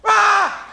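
high, loud burst of a scream; sudden loud mock outburst; exploding mother. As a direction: erupt like a mother losing it. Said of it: "they just explode".

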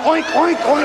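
pig oinks. Retold or imitated. Brother caught off guard in the restaurant, oinking high. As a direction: oink on reflex, caught off guard. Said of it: "He went".

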